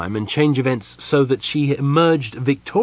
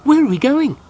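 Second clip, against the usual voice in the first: high, loud eager question; bursting to know where next; eager kender, high and louder; 7 dB louder than usual. A narrator's reading. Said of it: "he asked eagerly".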